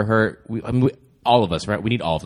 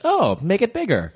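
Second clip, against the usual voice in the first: mock wife voice; 12 semitones higher, slower than usual.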